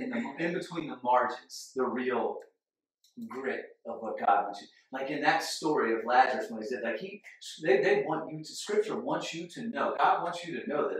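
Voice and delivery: ordinary talk, nothing marked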